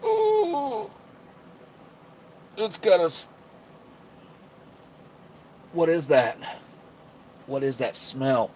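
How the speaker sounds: high pained ooh, recoiling; disgusted by smell. Real, not acted. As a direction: wince at a foul stink. Reacts to the beer's smell.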